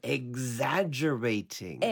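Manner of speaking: "Exaggerating" slow and whole, falling at the end; pronunciation demo. Shown as a demonstration. Teacher joining the syllables for the student, pronunciation demo. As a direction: model "Exaggerating" slowly for a learner. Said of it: "発音一緒にやってみましょう".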